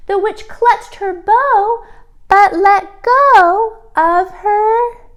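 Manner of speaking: ordinary delivery, nothing marked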